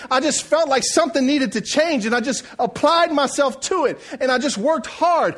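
high, impassioned sermon climax; full-force preaching. Acted. Preacher telling church he was ministered to, full-force preaching. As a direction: build it up and proclaim it.

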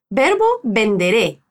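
ordinary talk, nothing marked

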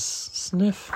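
soft breathy sniff; a small crying sniff; sniffle. Acted. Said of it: "Gerald's crying".

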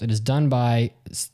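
usual speaking voice

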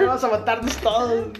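laughing mock suggestion. Joking with friends about everyone getting depressed, laughing. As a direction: toss it off through laughter.

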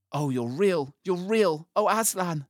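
put-on high, joyful girl's exclamations; cried out. A narrator's reading. Lucy finds Aslan is real, crying out. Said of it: "cried Lucy".

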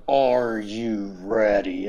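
imitating Hunter. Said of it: "he's like".

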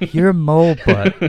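laughing quote. Retold or imitated.

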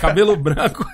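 amused words breaking into a laugh. Real, not acted. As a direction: finish the joke laughing. Both joking and laughing along.

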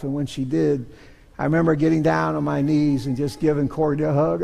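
normal talking voice

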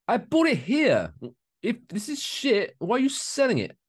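a mock-outraged customer complaining that a shirt she bought there is shit; indignant customer. Customer quoted at store clerks.

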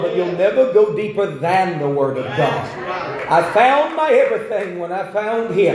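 high, loud preaching; preaching at full force. Acted. Pastor preaching hard to the congregation, loud and high. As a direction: build the sermon up, calling out.

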